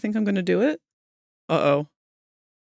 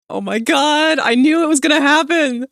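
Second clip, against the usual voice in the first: exasperated outburst; 7 semitones higher, 7 dB louder than usual. Real, not acted. Reacting to the chat's request.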